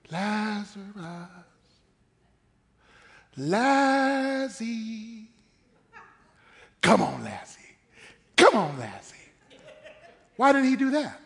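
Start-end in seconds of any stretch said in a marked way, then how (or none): 0.1-5.3 mock gentle, drawn-out coaxing call; just saying it. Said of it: "Why didn't he just say". 6.8-9.2 low, playful call as if to a pet; mock coaxing. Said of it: "Why didn't he just say".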